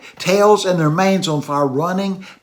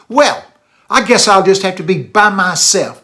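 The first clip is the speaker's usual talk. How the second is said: put-on higher, louder child voice, sulking; pouting little kid; 5 semitones higher, 7 dB louder than usual. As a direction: pout it out like a lonely five-year-old. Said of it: "as a pouting little kid".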